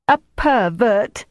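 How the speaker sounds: high, drawn-out gloating accusation; triumphant. A narrator's reading. Jelly exposes Greta before the others, triumphant. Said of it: "she said triumphantly".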